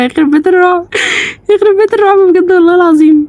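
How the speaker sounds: high, wailing scared curse; frightened cursing. Real, not acted. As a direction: curse the horror in a high wail. Cursing the horror after a scare.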